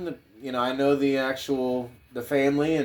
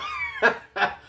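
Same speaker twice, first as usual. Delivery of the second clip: high laugh. Real, not acted. Laughing after joke.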